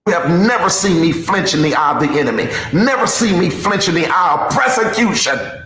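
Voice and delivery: loud, pounding sermon rant; full-force preaching. Real, not acted. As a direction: hammer every line out loud at the crowd.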